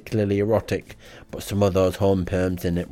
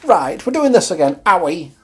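squeaky put-on voice; 10 semitones higher, 7 dB louder than usual. High-voiced figure scolds him.

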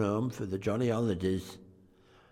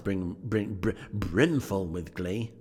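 plain reading voice

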